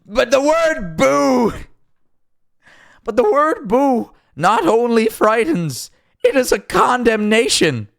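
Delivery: very high, slow, overwrought impression; failed Canadian accent, slow and high-pitched; Jordan Peterson. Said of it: "do my best impressions".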